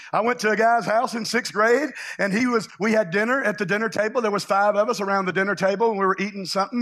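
ordinary talk

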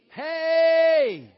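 very loud, high, drawn-out holler; whooping shout. Real, not acted. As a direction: holler it long to rouse the crowd. Whooping up the crowd.

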